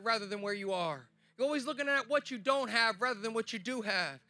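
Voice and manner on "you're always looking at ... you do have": usual speaking voice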